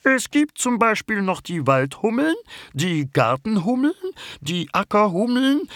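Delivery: very high bumblebee voice, eagerly explaining; the queen bumblebee teaches her friends. Acted. The bumblebee lists bumblebee species. (sehr hohe Hummelstimme, eifrig erklärend)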